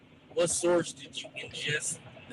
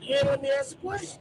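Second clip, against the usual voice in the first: exasperated; 11 semitones higher, 8 dB louder than usual. Real, not acted. Cutting in, frustrated.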